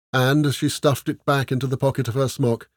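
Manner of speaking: ordinary delivery, nothing marked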